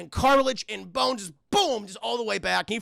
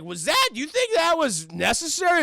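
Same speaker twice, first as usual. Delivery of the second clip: high-pitched mock outrage; indignant challenge; 7 semitones higher, 4 dB louder than usual. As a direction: demand an answer as a scandalised partner. Voices Hershey challenging Dredd.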